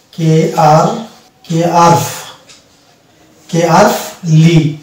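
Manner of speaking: কে আর লি spelled out slowly, part by part; slow syllable drill. Shown as a demonstration. Teacher drilling students slowly, কে আর লি syllable by syllable. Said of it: "একসঙ্গে টানতে হবে".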